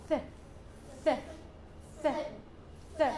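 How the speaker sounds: "th" as a short voiceless hiss, tongue at the teeth; soft TH sound. Shown as a demonstration. Teacher modelling the sound for learners, short soft "th". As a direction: say "th" alone as a brief soft TH puff. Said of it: "Soft T H pronounce it as".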